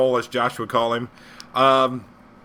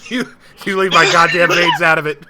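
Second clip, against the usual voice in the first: laughing protest; 2 semitones higher, 8 dB louder than usual. Real, not acted.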